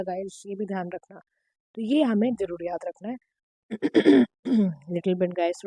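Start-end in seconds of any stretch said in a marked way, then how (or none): 3.7-4.9 real, loud throat clear. Real, not acted. Teacher pauses mid-lecture with a bad throat, clearing it. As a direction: clear a troubled throat before carrying on. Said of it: "थोड़ा गला ख़राब है".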